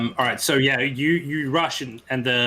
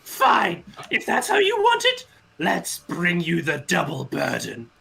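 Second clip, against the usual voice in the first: angry villain; 6 semitones higher, 3 dB louder than usual. Acted. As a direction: shout a threat as the puzzle master. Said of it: "And he says".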